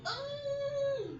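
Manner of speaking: high pained "oh" cry. Real, not acted. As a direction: cry out at the ankle pain. Ankle hurts suddenly.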